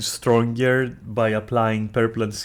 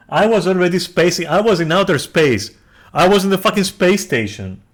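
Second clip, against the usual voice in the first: loud, raised-pitch rant; exasperated yelling; 6 semitones higher, 6 dB louder than usual. Real, not acted. Ranting to viewers about plot inconsistencies, yelling. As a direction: yell it in frustrated disbelief. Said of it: "for yelling".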